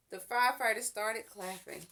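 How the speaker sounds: ordinary delivery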